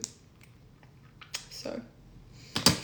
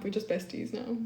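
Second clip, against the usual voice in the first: normal talking voice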